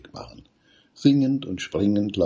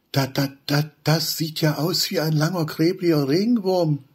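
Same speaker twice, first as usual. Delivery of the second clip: much higher stuttering fright; frightened stammer; 10 semitones higher, 5 dB louder than usual. Acted. Frightened Wulle spotting the sticky tongue, stuttering high. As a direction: stammer it out, scared. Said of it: "stottert Wulle".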